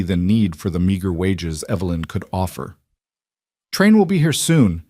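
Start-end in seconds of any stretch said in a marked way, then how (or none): 3.7-4.8 put-on older Polish woman in a thick Polish accent, pitched higher; lightly voiced Polish housekeeper, higher. A narrator's reading. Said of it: "in her thick accent".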